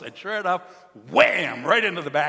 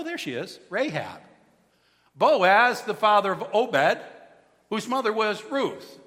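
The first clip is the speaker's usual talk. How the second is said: normal talking voice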